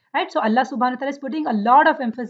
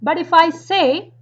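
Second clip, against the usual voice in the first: ordinary talk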